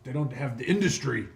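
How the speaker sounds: loud, scornful hobgoblin; callous hobgoblin boss. Acted. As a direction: brush it off with contempt. Hobgoblin mocks question of souls.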